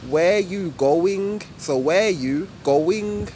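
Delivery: slow, even "Where you going?"; fifty-fifty normal way. Shown as a demonstration. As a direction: give "Where you" and "going" equal, drawn-out time. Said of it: "normal English way would be fifty fifty".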